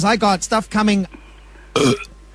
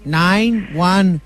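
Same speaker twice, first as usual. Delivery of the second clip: put-on accented numbers read while chewing; eating while talking; much slower than usual. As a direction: talk through a mouthful of food. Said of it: "a noise that keeps coming through the phone".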